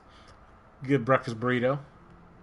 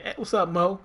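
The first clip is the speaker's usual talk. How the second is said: put-on DC accent; 7 semitones higher than usual. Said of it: "that D C accent".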